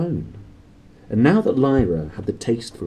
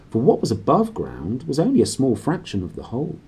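usual speaking voice

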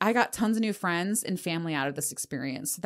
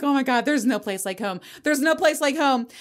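relieved excitement; 6 semitones higher, 3 dB louder than usual. Retold or imitated. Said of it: "I was like".

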